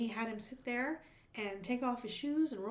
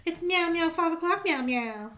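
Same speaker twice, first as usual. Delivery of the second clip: high, loud meowing kitty voice; Daniel Tiger voice; 5 semitones higher, 7 dB louder than usual. Acted. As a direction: meow like a puppet kitty. Imitating Daniel Striped Tiger.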